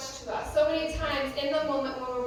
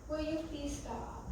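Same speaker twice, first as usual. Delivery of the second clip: softer and slow, a polite please stop; calm request; 2 semitones higher, 10 dB quieter than usual. Shown as a demonstration. Teacher showing first graders a calm request, soft and slow. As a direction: ask it gently, showing the better way. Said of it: "look at how much more effective that was".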